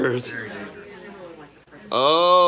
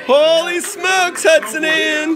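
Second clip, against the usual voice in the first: excited squeal; 12 semitones higher, 3 dB louder, slower than usual. Real, not acted. Excited exclamation at super chat.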